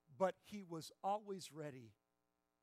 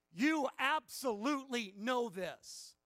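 louder, higher, slow insistence; emphatic insistence; 7 semitones higher, 11 dB louder, slower than usual. Retold or imitated. Preacher voicing Paul's certainty to the congregation, loud and insistent. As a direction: press it home as undeniable. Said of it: "What he is saying here is".

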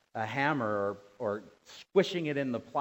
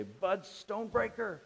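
high-pitched mock exclamation; pretend cursing; 7 semitones higher than usual. Preacher swearing by own name after hammering thumb, mock cursing. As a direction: raise the pitch as if swearing in pain. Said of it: "if you hammer your thumb".

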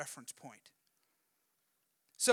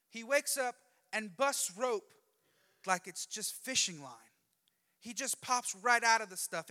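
usual speaking voice

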